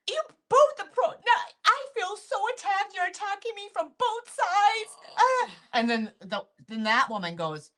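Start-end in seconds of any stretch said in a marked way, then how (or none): 0.1-5.2 high, loud mock sobbing wail; mock-sobbing the crying customer; imitating sobbing. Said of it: "now she is sobbing". 5.2-5.5 high loud sobbing cry; hammed-up sob; mock sob.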